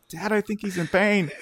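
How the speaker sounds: put-on high-pitched kid voice; mock child's voice; concerned child. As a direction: tell Dad like a worried little kid.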